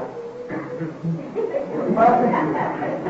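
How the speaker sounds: a long laugh, amused; hearty laugh. Real, not acted. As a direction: laugh it off, enjoying the joke. Laughs at own story.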